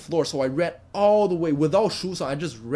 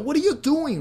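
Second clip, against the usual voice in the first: much higher, frantic mock cry; old lady pleading with the man to stop; imitating a panicked old lady; 13 semitones higher, 4 dB louder than usual. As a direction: squeal it like a frightened old woman. Old lady stopping knife attacker.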